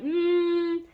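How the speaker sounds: high, drawn-out "mm" of hesitation; pondering hum. Retold or imitated. Diner considering an unknown dish on the menu, high pondering hum. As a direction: hum up high as if weighing a gamble. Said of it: "just been like".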